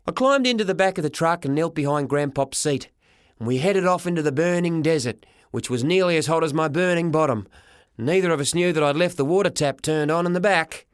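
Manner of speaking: usual speaking voice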